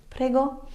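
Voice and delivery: "Prego" lifted high as a question; questioning intonation. Shown as a demonstration. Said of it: "use it with an intonation".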